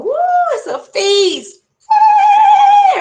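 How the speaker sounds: very high, loud festive shout; party cheer. Acted. Crowd celebrates the new king, high loud cheering. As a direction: whoop it up like a reveller.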